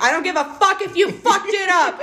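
mock-brash coach line through a laugh; Jada quote. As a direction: toss it off laughing, high and brash. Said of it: "she's literally like".

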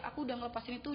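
ordinary delivery

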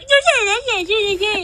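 high-pitched teasing parrot of the friend; mocking imitation. Guest shows how the friend would tease, mocking high voice. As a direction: mimic a friend's mockery in a squeaky high voice. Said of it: "you would have been like".

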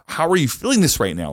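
mock-exasperated inner voice; retold inner panic. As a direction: replay a frantic argument with yourself. Panicked inner dialogue at the wedding.

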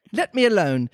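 put-on mildly indignant little girl. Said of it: "said Alice indignantly".

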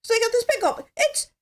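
mock late-night host joke; Johnny Carson impression. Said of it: "let's just pretend it's Johnny Carson".

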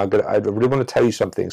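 fast, rushed and tumbling; hurried demo of rushing your words. Shown as a demonstration. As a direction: blurt it out as fast as you can. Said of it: "So I'm gonna try and rush it now".

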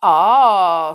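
loud, drawn-out ah; deep-voiced policeman. A narrator's reading. Policeman stepping from the shadows to the Sticks, deep-voiced. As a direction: announce yourself like a big policeman. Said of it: "said the policeman in a deep voice".

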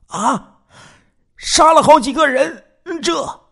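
very high-pitched gasping shock; squeaky-high, stammered astonishment; shocked disbelief. Acted. Hears of several killings, shocked.

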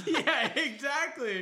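high, slow agreement through laughter; real laughter stretching the words; laughing. Real, not acted. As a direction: agree while cracking up. Laughing at the bunny joke.

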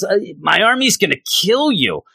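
lightly voiced Mojo threatening; a hint of a sneering villain; Mojo threat. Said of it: "So Mojo says".

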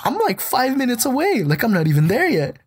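high-pitched protest, easing down a little; exasperated protest. Retold or imitated. Brother tells the old couple he's five minutes away, exasperated protest. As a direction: squeal it as the flustered brother's retort.

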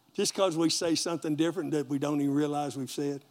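normal talking voice

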